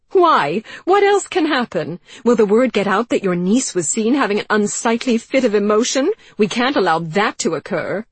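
high, loud sarcastic fury; sarcastic outburst. Acted. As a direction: lash out with bitter sarcasm. Anne's bitter sarcastic outburst.

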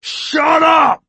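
high, loud barked shout. A narrator's reading. Mole silencing Two, loud shout. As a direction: shout him down. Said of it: "Mole shouted".

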